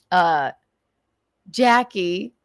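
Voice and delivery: usual speaking voice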